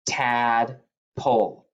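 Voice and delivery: Tadpole stretched out slowly, syllable by syllable; slow repeat. Shown as a demonstration. Teacher repeating the word slowly for the kids to write. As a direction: draw Tadpole out slowly for spelling. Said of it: "Number four".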